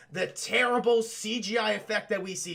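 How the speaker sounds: ordinary delivery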